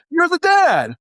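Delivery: high-pitched, gleeful-sounding reveal; spring it as a hammed-up surprise; exaggerated high reveal; mock big announcement. Acted announcement of fatherhood.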